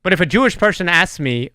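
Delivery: ordinary talk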